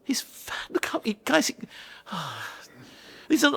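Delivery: breathless, squeaky disbelief; mock exasperation. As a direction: splutter as the sceptical dad. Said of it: "And I'll be like".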